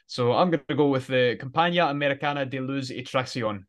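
Compañía Americana de Luz y Tracción said in careful Spanish; Spanish pronunciation. Shown as a demonstration. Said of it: "I dare you to pronounce that".

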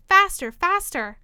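high, loud, drawn-out urging; crying out. A narrator's reading. Queen driving Alice to run, crying out. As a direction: cry each word out slowly and urgently. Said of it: "cried the queen".

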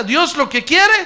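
call to repeat. Shown as a demonstration. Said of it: "A ver, diga".

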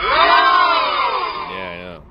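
very high, drawn-out shouted wail; mock shriek. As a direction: wail about the price in mock pain.

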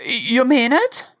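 put-on German accent. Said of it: "with German accents in mind".

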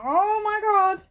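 slow, high, loud "oh my God"; shocked gasp. Retold or imitated. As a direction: drag it out in shock. Retold gossip reaction.

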